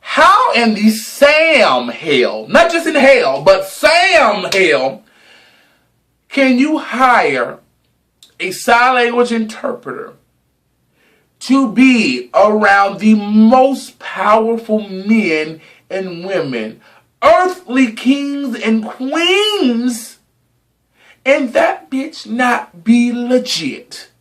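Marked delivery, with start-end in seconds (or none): 0.0-5.0 slow, stretched, high outraged emphasis; drawn-out outrage. Real, not acted. Dramatic outrage rant. 17.2-20.2 very slow, high, stretched words; drawn-out emphasis. Real, not acted. Dramatic emphasis.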